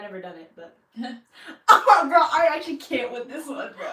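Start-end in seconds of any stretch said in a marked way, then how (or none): 1.7-3.9 loud laughing exasperation; cracking up in disbelief. Real, not acted. Laughing disbelief at chat question.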